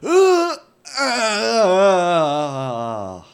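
high strained uh sounds; waking groans. Acted. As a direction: groan yourself awake. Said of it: "I wake up and I just go".

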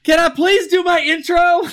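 high-pitched comic whine for attention; mock exasperated plea. Mock pleading after panel interruptions.

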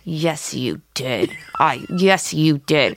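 low, slow, dead-serious insistence; through the teeth. Retold or imitated. Grandmother insists on her accusation to her daughter, through her teeth. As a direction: grit it out through clenched teeth. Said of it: "Talking through her teeth".

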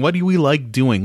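normal talking voice

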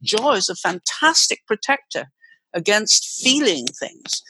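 normal talking voice

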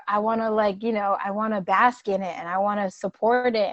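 ordinary talk

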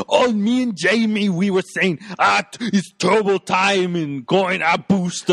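put-on action-star dad voice; hammed-up movie father, slowing at the catchphrase; Schwarzenegger. Host as dad reporting back to wife, hammy impression. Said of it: "he's like".

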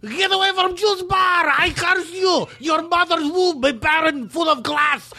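put-on high shouted curses in a foreign accent; laying a curse. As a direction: scream curses at the customer, furious. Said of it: "Just do it even meaner".

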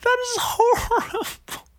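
high wailing no; anguished cry. Real, not acted. Reading of a crushed bulldog, crying out high.

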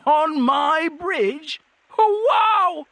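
high, slow amazement; awestruck troll. A narrator's reading. Troll amazed Cohen is on its bridge, awestruck. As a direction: marvel slowly, pitched right up.

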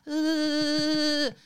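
loud, high, drawn-out "uhhh"; shaking noise. Shown as a demonstration. Imitating co-host's shaky hands, drawn-out high noise. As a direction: make a long mock shuddering noise.

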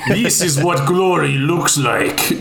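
slow, self-admittedly bad mock Russian accent; a slow, shaky attempt at a Russian accent. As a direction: drawl the villain's line in a hammed-up Russian accent. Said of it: "I wanna hear this Russian accent".

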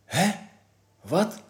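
short, high questioning yelps; disbelieving, rising blurts; startled. A narrator's reading. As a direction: react in baffled surprise. Kees startled by alarming news.

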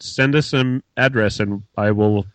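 normal talking voice

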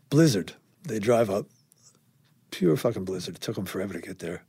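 ordinary talk

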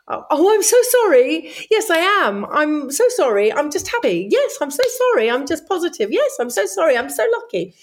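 high, mock-apologetic patter, dropping on each excuse; apologetic British reaction. Apologising to everyone for being happy, mock sheepish. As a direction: parody a very British over-apology. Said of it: "natural sort of British reaction".

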